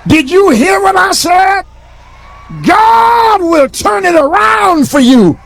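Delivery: preacher climbing to a roar; preaching, building to a shout. Acted. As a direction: build from firm to full shouting. Building preaching call and response.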